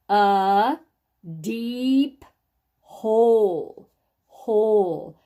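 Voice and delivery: loud, isolated words with long pauses; word-by-word reading. Shown as a demonstration. Teacher reads the sentence with the class, word by word. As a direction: stretch each word for beginning readers. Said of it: "after we've sounded it out".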